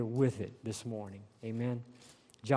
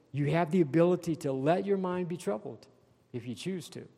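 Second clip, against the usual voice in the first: ordinary talk, nothing marked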